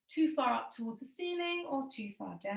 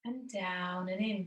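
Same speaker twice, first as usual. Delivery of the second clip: slow breathing cue; 4 semitones lower, 6 dB quieter, slower than usual. Shown as a demonstration.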